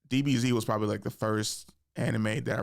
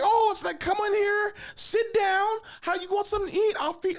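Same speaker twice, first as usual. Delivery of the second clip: sister fussing over guest. Retold or imitated. Sister inviting her boyfriend in, hammed-up high welcome. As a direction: coo it like an overeager host. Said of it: "She's like".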